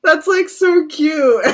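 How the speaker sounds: slow words through real giggles; gushing, barely holding it together; laughing. Real, not acted. Reader cracking up over the cute duo, laughing.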